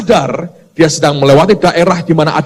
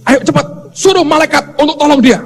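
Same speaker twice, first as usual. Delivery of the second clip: higher, fast urgent command; urgent God; 10 semitones higher, faster than usual. Retold or imitated. God urging the angels to rescue the man, fast and higher. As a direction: hurry the angels along. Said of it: "Tuhan berkata".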